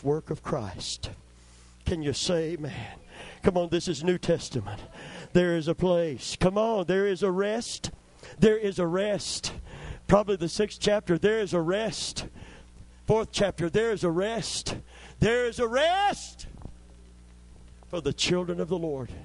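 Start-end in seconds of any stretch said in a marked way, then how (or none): 3.4-14.8 higher and louder, driving preacher's call to the church; fiery preaching. Acted. Preaching at full force. 15.2-16.5 "There is a rest" slowed, much higher and louder, leaning on "rest"; climactic preaching. Acted. Climax of the repeated verse.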